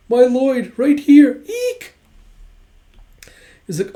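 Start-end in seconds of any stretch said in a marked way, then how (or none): 0.1-1.9 rising higher and louder, ending in a squeak; excited squeal. Retold or imitated. Becky swooning over Lloyd beside her, excited squeal. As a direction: gush over him until it breaks into a squeal.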